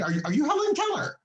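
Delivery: high, fast, sarcastic jab; sarcastic high jab at the nurse; mocking sarcasm. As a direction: rattle off the insult in disbelief. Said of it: "retold rant at nurse".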